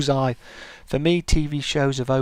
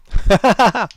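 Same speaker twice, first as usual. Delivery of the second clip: high, loud burst of laughter; amused high cackle; real laugh; 7 semitones higher, 5 dB louder than usual. Real, not acted. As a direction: laugh out in a quick volley. Amused by chat reply.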